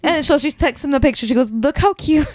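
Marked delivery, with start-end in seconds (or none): 1.5-2.3 cutesy wife voice. Acted. Wife's text read aloud.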